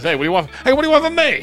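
jumps high, a mock startled "what do you want"; mock indignant. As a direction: play a put-upon guy, suddenly high. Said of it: "I go like this".

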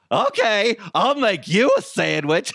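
high, manic cackling clown voice; gleefully sinister, breaking into a cackle; evil clown. Acted. Robot answers its owner's sandwich order, evil clown voice. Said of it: "It's an evil clown".

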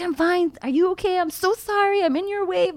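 very high retold apologetic babble; high-pitched apology. Crash victim apologising to truck driver, high-pitched.

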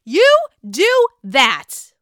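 high, loud approving cheer; gleeful cheering. Retold or imitated. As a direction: egg her on with relish. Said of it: "I'm like".

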